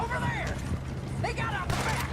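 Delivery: high urgent call across the yard. Acted. Lookout spotting the fleeing pair, urgent call. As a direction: point them out to everyone. Calling out during a chase.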